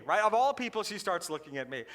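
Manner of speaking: ordinary delivery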